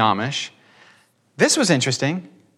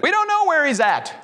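much higher, fretful mock voice; 13 semitones higher than usual. Mocking relatives unsure of the dead man's fate, high fretful. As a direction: imitate the doubting relatives, pitched up. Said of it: "Hope so is what that".

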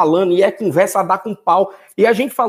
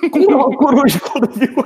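laughing; 6 semitones higher, 4 dB louder than usual. Real, not acted. Laughing disbelief at the saying.

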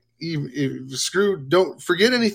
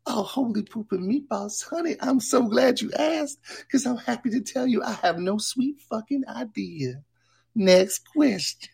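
much higher sassy persona voice; Jessica character voice; 5 semitones higher, 4 dB louder than usual. Acted. Jessica answers silly chat questions, high and campy. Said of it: "He even calls himself Jessica".

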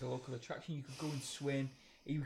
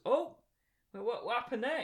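surprised mate; 7 semitones higher than usual. Retold or imitated. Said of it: "and then he goes".

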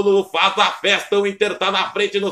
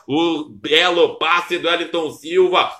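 ordinary talk

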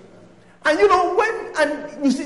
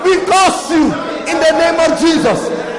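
loud, higher, shouted curse; laying a curse; 5 semitones higher, 3 dB louder than usual. Acted. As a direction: hurl the curse like a command. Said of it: "we are gonna curse it again".

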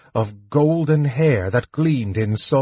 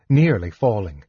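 ordinary delivery, nothing marked

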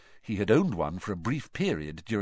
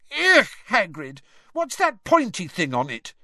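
higher and louder, recoiling; disgusted; 11 semitones higher, 5 dB louder than usual. A narrator's reading. Girl asks Hagrid about a sting, disgusted. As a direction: squeal at the pointy thing. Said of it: "said Lavender Brown again".

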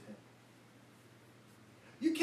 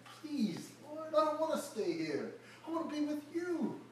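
begging; 9 semitones higher than usual. Acted. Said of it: "He begged him and said".

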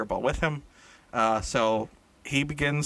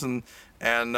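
ordinary delivery

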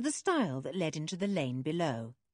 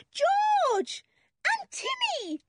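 put-on very high, drawn-out girl's yell; high squealed greeting; 6 dB louder, much slower than usual. A narrator's reading. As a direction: call out joyfully to arriving friends. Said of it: "yelled Anne".

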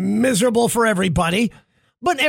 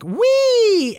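squeaky high toy squeal; giggly doll voice; 12 semitones higher than usual. Retold or imitated. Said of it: "the doll gasps and giggles and says things like".

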